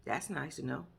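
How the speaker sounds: mimicking his flat, low, quiet reply; deadpan low mumble, unimpressed; flat deadpan mimic. Mocking the husband's cold reply, low and deadpan. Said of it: "this motherfucker response was".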